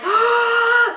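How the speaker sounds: a sharp loud high gasp; huge gasp. Retold or imitated. Mom seeing the grandma necklace, huge surprised gasp. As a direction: gasp in shock. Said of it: "a huge gasp".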